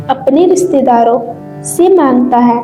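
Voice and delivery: plain reading voice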